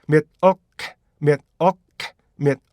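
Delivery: 밀크 in separate short beats, myet, uh, k; split-up milk. Shown as a demonstration. Teacher breaks milk into parts for learners, segmented pronunciation. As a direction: chop "밀크" into myet-uhk with tiny gaps. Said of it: "이 세 개를 똑같이".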